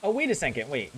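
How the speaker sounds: ordinary delivery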